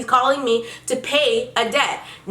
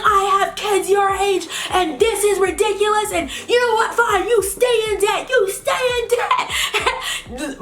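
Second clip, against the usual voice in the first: much higher, laughing imitated scream; mock screaming collector; 8 semitones higher, slower than usual. Collector screaming over a small debt, hammed-up high mock yell. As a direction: ham up a shrieking angry man. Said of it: "while he's screaming on the other side".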